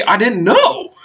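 high-pitched, dramatic protest; hammed-up upset cry; anguished outburst. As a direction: burst out in hurt disbelief. Acted outburst of grief.

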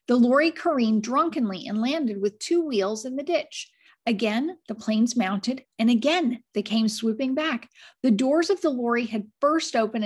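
plain reading voice

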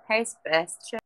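ordinary talk, nothing marked

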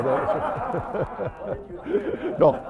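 breaking into laughter while backtracking; laughing at your own joke. Real, not acted. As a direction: laugh through the words, pitch jumping high. Jokes about complaining about bishops.

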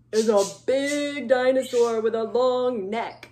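loud, weighty emphasis; big voice. Shown as a demonstration. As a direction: make the dinosaur sound huge. Describing the big dinosaur.

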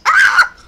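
very high, loud shriek; piercing scream. Real, not acted. Player attacked by Piggy, piercing scream.

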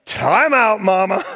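loud, high mock stern "Time out"; mock scolding son. Son catching his seventies mother reading smut novels, mock scolding. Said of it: "and I'm like".

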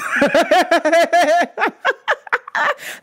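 bright burst of laughter; high laugh. Real, not acted. Host laughing about Carnival outfits, a high laugh.

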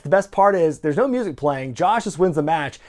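ordinary talk, nothing marked

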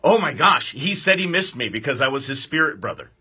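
ordinary delivery

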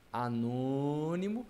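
ANÔNIMO drawn out slowly, syllable by syllable; stretched syllables. Shown as a demonstration. Teacher giving an example, anônimo slowly drawn out. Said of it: "por exemplo".

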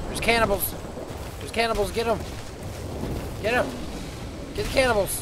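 high, loud battle yells; rally the beast into the fight; excited cheering. Real, not acted. Urging his pet dinosaur to attack the cannibals, high excited shouts.

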